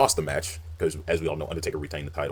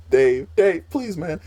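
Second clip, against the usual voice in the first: higher, louder begging whine; mock pleading Rey; 12 semitones higher, 6 dB louder, much slower than usual. Imitating Rey Mysterio begging Batista not to hurt him. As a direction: plead for mercy in a squeaky voice. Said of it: "He's like".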